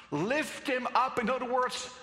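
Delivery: preacher crying the crowd's demand to the congregation, loud and urgent. Acted.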